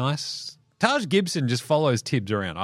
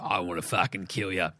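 low, hushed murderous mutter; mock killer threat; menacing Draymond; 9 semitones lower, 7 dB quieter than usual. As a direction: growl it under your breath like a thug. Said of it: "he's like".

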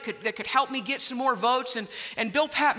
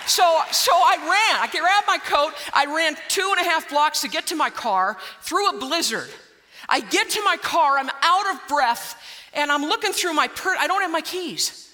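breathless rush; 5 semitones higher, 3 dB louder than usual. Retold or imitated. Running through blizzard to car.

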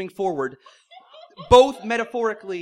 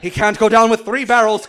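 moan; 2 semitones higher, 11 dB louder than usual. Acted. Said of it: "Quint moaned".

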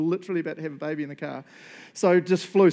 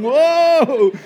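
loud, high whoa; jolted into a sprint; retold startled yell; startled shout; 12 semitones higher, 15 dB louder than usual.

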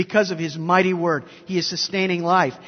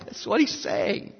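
hushed whispered repeat; 7 dB quieter than usual. Shown as a demonstration. Preacher echoing his point to the congregation, whispered. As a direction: drop to a whisper for the echo.

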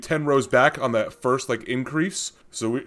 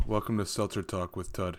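flat, quiet monotone voice; 5 semitones lower, 11 dB quieter than usual. Pretend. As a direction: drone it with no emotion. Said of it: "in a monotone".

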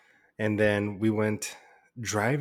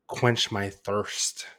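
slow drawl; much slower than usual. Real, not acted. Drawn-out drink intro.

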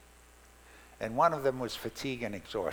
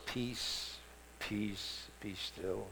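soft and soothing, much softer; gentle; 5 semitones lower, 9 dB quieter, much slower than usual. Shown as a demonstration. Preacher showing the gentle reading, soft and soothing. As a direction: breathe it out like a lullaby. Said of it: "they're kinda nice words".

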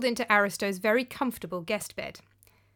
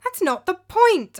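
much higher and louder, slow and hot; angry cry; 10 semitones higher, 8 dB louder, slower than usual. Acted. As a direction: insist with heat. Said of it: "I cried".

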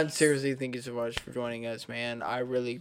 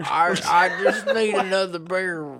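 mock drunk rant; 2 semitones higher, 5 dB louder than usual. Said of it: "when I'm drunk".

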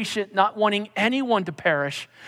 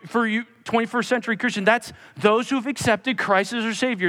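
ordinary talk, nothing marked

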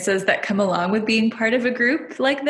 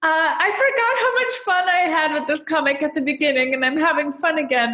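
laughing; 7 semitones higher than usual. Real, not acted. Laughing while recalling fun.

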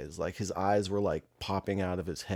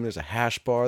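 ordinary delivery

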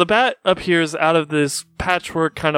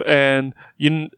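ordinary delivery

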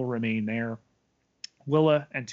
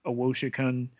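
ordinary talk

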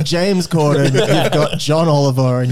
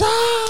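audience gasp. Retold or imitated. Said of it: "the audience goes".

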